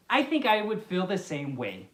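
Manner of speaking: ordinary delivery, nothing marked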